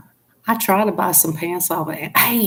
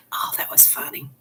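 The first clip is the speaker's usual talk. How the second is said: hushed giggly whisper; whispered aside; 9 dB quieter than usual. Real, not acted. Telling viewers about butt cream in a whisper. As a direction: confide a silly find. Sharing a silly ad.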